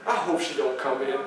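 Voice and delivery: low, hushed worried thought; saying to himself. Acted. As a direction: drop to a soft private aside. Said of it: "And I said to myself".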